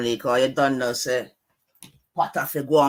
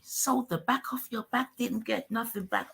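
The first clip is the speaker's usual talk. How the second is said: mocking the line back in a higher voice; mock high echo; 8 semitones higher, 4 dB quieter than usual. Streamer echoes the guest's words back in a mocking higher voice. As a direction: parrot it back higher, teasing.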